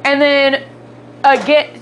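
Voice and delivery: ordinary talk, nothing marked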